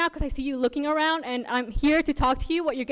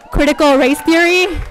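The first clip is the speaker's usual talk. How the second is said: very loud, higher-pitched proclamation; raised voice, driving the words home; emphatic declaration; 4 semitones higher, 13 dB louder than usual. Real, not acted. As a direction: belt out the credential at the room. Pointed boast to council crowd.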